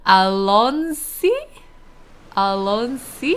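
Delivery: rising, unsure ALONSI; questioning pronunciation. Real, not acted. Streamer asking chat how to say it, questioning. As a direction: draw out Alonsi and lift the end. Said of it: "is that how you say it".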